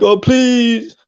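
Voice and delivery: high, drawn-out mocking whine; mock crying plea. Taunting rival about crying last day, mock crying plea. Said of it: "You were crying there last day".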